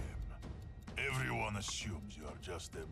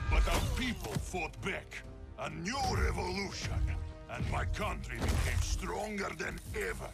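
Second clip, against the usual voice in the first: high, rousing declaration; stirring, high-pitched soldier's proclamation; rousing patriotic pride; 9 semitones higher, 3 dB louder than usual. Acted. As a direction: proclaim the uprising with raised pitch. Soldier proudly recounts national uprising.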